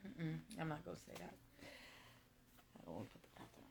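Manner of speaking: hushed whisper, mostly breath, to herself; a quick private whisper, as if stopping herself from saying too much; whispered aside. Real, not acted. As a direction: catch yourself and whisper it away. Holds back news, aside to self.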